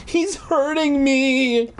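put-on high, slow childish wail. Trapped little girl complaining of pain, wailing. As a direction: stretch it into a whiny cry. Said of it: "Alicia wailed".

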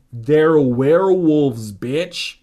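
slow, loud hammed-up shout; loud and urgent. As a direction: bark it out with mock drama. Urgent warning to friend.

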